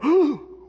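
a big, high, hammed-up gasp; mock gasp. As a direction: gasp in fake shock. Wife discovering the tidy house.

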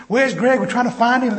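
loud, high calling out; yelling. Retold or imitated. Younger brother yelling along the beach looking for his brother. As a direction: shout it across a crowded beach. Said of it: "he's yelling at this beach".

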